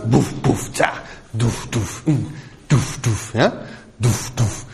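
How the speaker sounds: low, thudding drum sounds; low vocal kick drum, duff duff; bass drum imitation. Shown as a demonstration. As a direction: beat out the kick drum with your voice. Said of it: "nur die Basstrommel ist anders".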